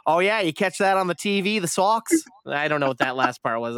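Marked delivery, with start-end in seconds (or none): 0.1-2.1 light mock Boston accent; a hint of Boston accent. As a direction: imitate the accountant asking about the Sox. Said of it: "That's not how he would say it".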